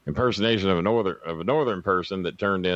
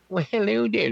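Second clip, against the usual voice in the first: much higher, prim nerdy voice; 14 semitones higher than usual. Retold or imitated. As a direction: chirp it like a prissy nerd.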